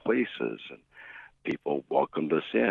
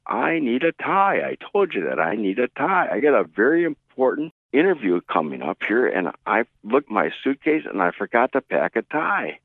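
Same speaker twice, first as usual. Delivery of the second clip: loud, higher insistent complaint; irate; 7 semitones higher, 4 dB louder than usual. Retold or imitated. Flustered guest demands a tie from the clerk, loud and irate. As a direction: hammer home that he needs a tie.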